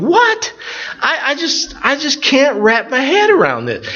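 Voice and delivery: very high exasperated exclamation; baffled disbelief. Real, not acted. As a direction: pitch it way up, utterly dumbfounded. Exclaims bafflement at zombie shows.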